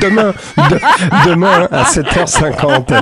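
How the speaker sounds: slow, high words through laughter; helpless amused delivery; laughing answer. Real, not acted. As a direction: crack up while giving the time. Laughing after being teased about chronicle.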